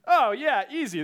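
breezy, overconfident brush-off; acted cocky confidence; super confident. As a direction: toss it off like it's nothing. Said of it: "you're super confident".

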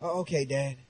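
low, soft imitation of a let-down child; heartbroken child. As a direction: voice a disappointed kid giving in quietly. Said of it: "I hear their heart breaking".

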